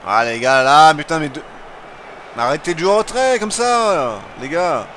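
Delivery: loud, exasperated yelling; frustrated shouting. Real, not acted. Yelling at players to play forward.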